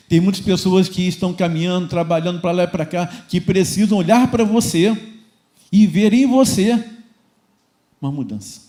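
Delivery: loud, insistent pulpit delivery; rousing charge to the congregation; fervent preaching. Real, not acted. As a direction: build to a forceful, slowing exhortation. Preacher rises to full force.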